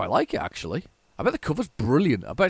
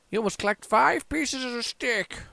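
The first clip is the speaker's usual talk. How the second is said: put-on old man voice; 11 semitones higher than usual. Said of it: "All right, old man".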